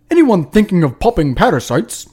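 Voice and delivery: badly faked British accent, swooping pitch; botched British accent. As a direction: attempt a British accent and miss. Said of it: "what kind of accent is that".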